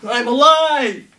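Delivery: high loud slow cheer; triumphant shout. Acted. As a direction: proclaim being alive. Triumphant revival.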